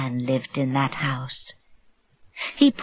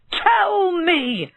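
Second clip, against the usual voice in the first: shouting; 12 semitones higher than usual. Acted. Bird seller yells at the dwarf near his birds, shouting. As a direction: scream it at the intruder, drawn out. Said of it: "he shouted".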